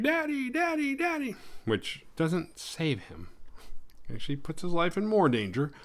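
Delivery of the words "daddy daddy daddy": put-on much higher girlish cry of daddy; imitating a frantic daughter, high; mock girl calling dad. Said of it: "She's screaming".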